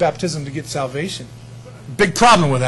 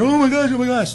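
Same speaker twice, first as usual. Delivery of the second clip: mock cowering fear; 8 semitones higher than usual. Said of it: "not cowering in fear".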